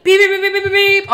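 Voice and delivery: high, loud rapid beeps; frantic honking done by voice; imitated car honking. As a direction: mimic an insistent car horn. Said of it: "This dude is honking".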